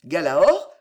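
loud, baffled echo of the name; the innkeeper echoing the name in loud astonishment; stupefied echo. Acted. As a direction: repeat it back, dumbfounded. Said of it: "fit Landry stupéfait".

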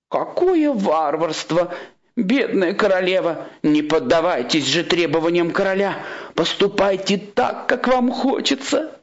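monk urging the queen on, slowing at the end; indignant, sympathetic monk. Acted. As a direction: exclaim with theatrical pity, pitched high.

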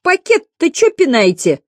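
slow, high-pitched indignant snap; schoolgirl's drawn-out angry complaint; angry snarl. A narrator's reading. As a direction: whine it angrily, stretched and high. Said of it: "злобно рявкнула какая-то девица".

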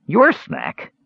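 high-pitched incredulous echo; disbelief. A narrator's reading. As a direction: throw it back in disbelief. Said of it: "said in disbelief".

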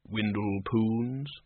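very slow, soft, drawn-out summons; looming shadowy figure. Acted. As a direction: call the name slowly and softly from behind. Shadowy figure addresses Windle from behind.

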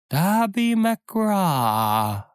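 high, drawn-out wailing name; ghostly whining. Acted. Ghostly voice wails a name at the frightened pirates. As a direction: wail it like a lost soul from afar. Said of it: "winselte es".

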